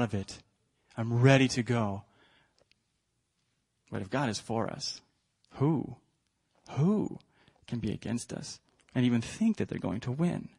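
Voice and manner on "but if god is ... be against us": hushed. Acted. Preacher contrasts loud and hushed.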